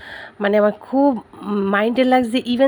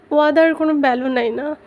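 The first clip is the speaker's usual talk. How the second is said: crying; 4 semitones higher than usual. Real, not acted. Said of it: "আমি কেন কান্না করতেছি".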